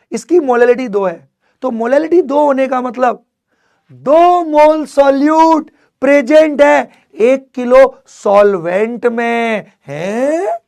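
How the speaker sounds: high, loud and slow, every word pressed; emphatic teacher. Shown as a demonstration. Teacher booming the meaning of molality to students. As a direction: proclaim the definition to the back row. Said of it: "teacher emphatic explanation".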